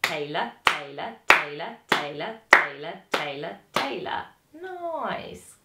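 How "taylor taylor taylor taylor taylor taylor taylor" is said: "Taylor" two even halves of a beat; rhythmic counting. Shown as a demonstration. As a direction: chant "Taylor" in quavers. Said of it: "That's a two syllable word".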